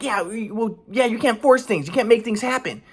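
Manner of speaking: mocking mom. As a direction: mimic mom brushing him off. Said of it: "my mom's like".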